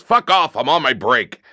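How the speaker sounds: acted snap to get rid of someone; smoker on break. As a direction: reenact a gruff dismissal on a cigarette break. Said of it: "classic smoking a cigarette".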